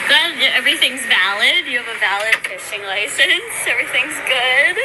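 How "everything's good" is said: ordinary talk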